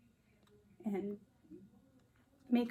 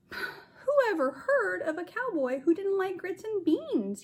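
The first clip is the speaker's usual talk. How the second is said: put-on a touch of kid's dismay, pitched up; lightly voiced boy, dismayed; 10 semitones higher than usual. Avery doubting himself at camp, mildly dismayed. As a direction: wonder aloud like a let-down little boy. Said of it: "he thought".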